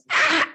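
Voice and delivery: short, high mock scream of pain. Showing how the crushed hand hurt, mock pained scream. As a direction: re-enact a sharp yelp of pain. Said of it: "I was screaming with pain".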